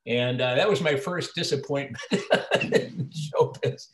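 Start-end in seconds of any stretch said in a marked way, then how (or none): 1.3-3.9 breaking into a real laugh mid-sentence. Real, not acted. Actor admitting his first showbiz disappointment, laughing as he says it. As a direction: laugh through the words, ruefully.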